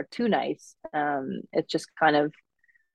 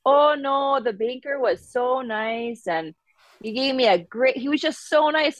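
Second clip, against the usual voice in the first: put-on Minnesota nice accent; 8 semitones higher than usual. Mom happily reports the nice banker, thick Minnesota accent. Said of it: "dial up that accent".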